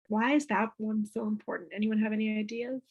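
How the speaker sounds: normal talking voice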